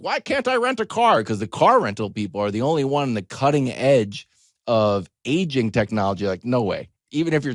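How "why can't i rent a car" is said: much higher, a hint of a complaining twenty-something; mock whiny young adult. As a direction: whine lightly like a young renter.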